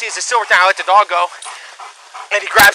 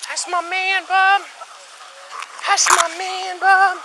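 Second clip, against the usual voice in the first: fond dog praise; 11 semitones higher, 5 dB quieter, much slower than usual. Real, not acted.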